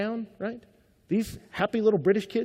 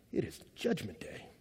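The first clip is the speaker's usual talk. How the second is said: low, hushed pronouncement of doom; quiet, fateful warning; ominous verdict; 8 semitones lower, 14 dB quieter than usual. Retold or imitated. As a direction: drop low and soft, gravely. Guide foretells colleague's doom.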